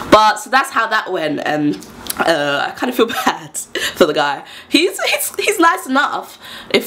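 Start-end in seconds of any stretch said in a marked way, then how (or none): none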